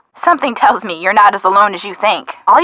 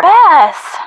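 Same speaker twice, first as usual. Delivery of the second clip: exasperated call; 7 semitones higher, 4 dB louder than usual. Acted. George cuts off Bess's tease.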